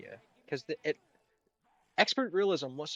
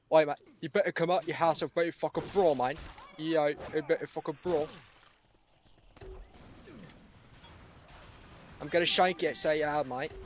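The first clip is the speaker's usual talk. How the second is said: higher, louder mock Brummie, veering Australian; thick Birmingham accent; 3 semitones higher, 4 dB louder than usual. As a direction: imitate a buddy spoiling for a fight in broad Birmingham. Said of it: "thick Birmingham accent".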